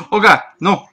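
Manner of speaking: panicked gasping scream; terrified horror victim. Real, not acted. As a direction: shriek in sudden terror. Creeper appears, panicked screaming.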